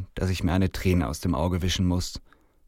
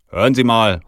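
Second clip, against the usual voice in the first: loud, raised protest; indignant call; 4 semitones higher, 11 dB louder than usual. A narrator's reading. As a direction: call out to put him in his place. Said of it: "rufe ich".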